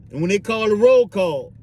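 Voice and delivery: ordinary delivery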